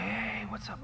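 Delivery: a friendly greeting kept under the breath; whisper. Retold or imitated. Omar answering his buddy in a whisper. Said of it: "he's like".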